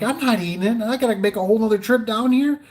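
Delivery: panicking to yourself, voice climbing; high-pitched frantic self-talk; frantic inner panic. Retold or imitated. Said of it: "And I'm like".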